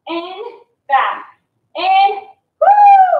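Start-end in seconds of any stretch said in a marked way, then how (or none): 2.6-3.1 loud, high whoop; excited cheer. Real, not acted. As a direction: cheer the class on. Cheering class mid-set.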